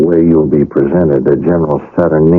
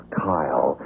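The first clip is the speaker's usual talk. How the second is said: hushed name; 3 semitones lower, 10 dB quieter than usual. Acted. Reveals a secret name.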